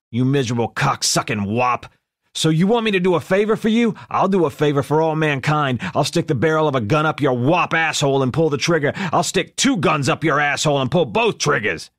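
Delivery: raging gangster yell; furious shout. A narrator's reading. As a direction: bellow it like a furious mob boss. Furious gangster threatens caller.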